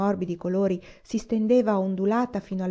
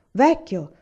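the repeated "vecchio" lifted higher and louder; proud insistence, louder on the repeat; proud exclamation, repeated higher; 4 semitones higher, 7 dB louder than usual. A narrator's reading. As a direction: press the second "vecchio" up, more insistent. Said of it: "esclamò orgogliosamente".

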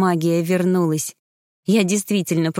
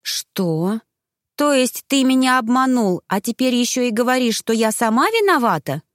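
higher-pitched, offended outburst; hurt indignation; 6 semitones higher than usual. A narrator's reading. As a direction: accuse him, stung and incredulous. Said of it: "Внутри заклокотала настоящая обида". (с обидой и возмущением)

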